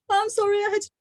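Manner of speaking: high, breathless apology. Retold or imitated. To the midwife, mid-labor, apologizing in a high breathless voice. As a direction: apologize high and breathless, right after a gasp. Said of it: "And I said".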